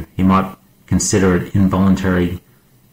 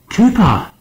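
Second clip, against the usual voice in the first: high, loud call; urgent high-pitched cry; shouted name; 5 dB louder than usual. A narrator's reading. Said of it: "Stark shouted".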